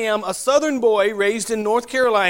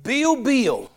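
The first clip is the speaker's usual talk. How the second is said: Southern accent; 4 semitones higher than usual. Shown as a demonstration. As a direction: say both names as "Beale" in Southern US style. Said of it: "in my southern accent".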